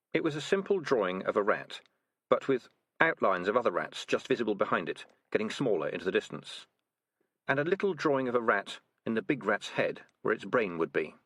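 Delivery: ordinary delivery, nothing marked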